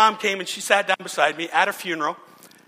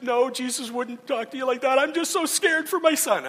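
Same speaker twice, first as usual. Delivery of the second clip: higher, a touch of tearful crying; mother, tearful, admitting her fear for her son; retold tearful reply; 9 semitones higher than usual. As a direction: let the voice rise as she breaks down. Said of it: "she started to cry".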